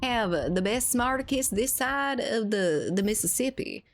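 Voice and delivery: lightly voiced Southern character boasting. Streamer doing a light Southern voice for the paladin character. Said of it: "So that's my southern voice".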